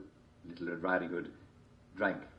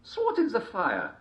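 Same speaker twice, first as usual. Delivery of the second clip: high wolf voice; 13 semitones higher, 5 dB louder than usual. A narrator's reading.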